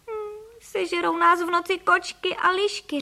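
put-on high whimpering child voice. A narrator's reading. Boy fearing cats and foxes at night, whimpering. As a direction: whine it like a frightened boy. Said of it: "I chlapci začali pofňukávat".

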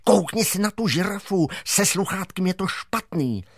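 a hint of a worried schoolgirl; girl Šebestová. Schoolgirl tells Mach the giraffe's receiver is in trouble, lightly worried.

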